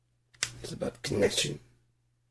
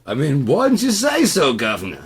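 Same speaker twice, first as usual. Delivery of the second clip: put-on high, loud British accent; 7 semitones higher, 7 dB louder than usual. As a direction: redo it as a proper British gent. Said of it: "the British accent".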